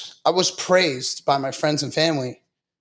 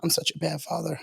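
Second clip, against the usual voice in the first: an unvoiced whisper, far softer, dad talking to himself in his cell; 2 semitones higher, 10 dB quieter than usual. Dad in his jail cell blaming himself, hushed whisper. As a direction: breathe the words like a man ashamed talking only to himself. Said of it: "he was telling himself".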